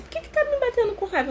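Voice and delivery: put-on high, fast, wide-eyed child voice; a small girl asking why she's being hit; indignant child. Child's thought while being hit.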